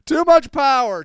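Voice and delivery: loud, high-pitched cry; hammed-up high yell; mock outburst. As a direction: shout it out over the top. Mock outburst repeating the phrase.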